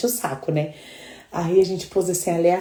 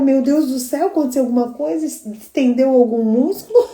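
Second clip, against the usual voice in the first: mock alarmed daughter; 8 semitones higher, 6 dB louder than usual. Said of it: "Eu falo".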